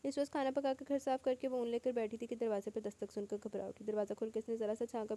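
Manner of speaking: ordinary delivery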